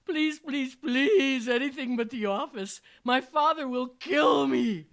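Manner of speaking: high, very loud melodramatic begging; hammed-up tearful plea. A narrator's reading. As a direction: beg for your life, overacting wildly. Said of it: "tearfully begged".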